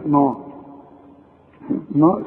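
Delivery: نا said as a plain na sound; name the letter نا, short and open. Shown as a demonstration. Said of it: "صدقني". (نطق نا بوضوح)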